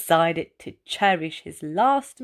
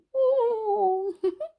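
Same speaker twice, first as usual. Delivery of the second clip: joyful laugh; 12 semitones higher than usual. A narrator's reading. Ray lands safely.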